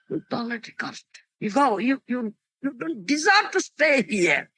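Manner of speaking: angry curse. Retold or imitated. Narada cursing the twin brothers.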